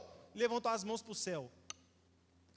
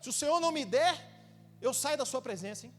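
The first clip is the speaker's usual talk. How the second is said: loud, higher, demanding whine at God; mock complaining worshipper; 6 semitones higher, 5 dB louder than usual. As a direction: badger God impatiently, hammed-up. Imita fiel reclamando com Deus. (fiel reclamando com Deus, alto e exigente)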